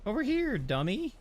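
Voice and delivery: high sing-song taunt; taunting call. Acted. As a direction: call it over, teasing. Calling a mob over.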